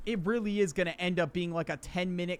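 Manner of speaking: normal talking voice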